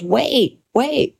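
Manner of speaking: mild mock yell of "Wait, wait", pitch rising; retold scream. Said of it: "and he screams".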